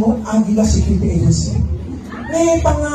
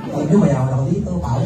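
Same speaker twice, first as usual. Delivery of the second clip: much lower, fast swagger; imagined con man; 8 semitones lower than usual. Retold or imitated. Imagining a con man bragging to two people, low and fast. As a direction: brag like a smooth talker.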